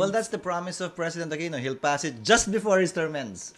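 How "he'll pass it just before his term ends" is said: "just" leaned on and drawn out; slow down and weight the word "just"; stress on JUST. Real, not acted. Relaying the President's promise to the interviewer, pointed stress on "just". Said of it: "Emphasis on the just".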